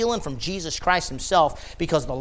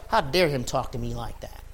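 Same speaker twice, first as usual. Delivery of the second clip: sulky Judas; 6 semitones lower, 9 dB quieter than usual. Retold or imitated. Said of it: "he's like".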